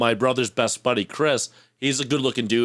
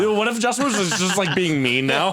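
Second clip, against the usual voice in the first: excited mock worry; 7 semitones higher than usual. Real, not acted. Imagining Justin turning mean, excited mock worry. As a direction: play up the alarm. Joking hypothetical, raised voice.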